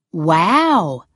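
a big, loud wow that swoops; amazed. A narrator's reading. Girl reacting to an eightieth birthday, amazed. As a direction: exclaim it in amazement.

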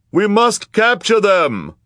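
high, loud, drawn-out shout; crowd member calling out. A narrator's reading. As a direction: cry out to rally a crowd. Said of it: "called a voice in the crowd".

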